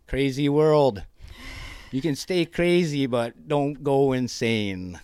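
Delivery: big rousing sign-off; emphatic proclamation. Acted. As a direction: declare it like a motto. Preachy closing line to listeners.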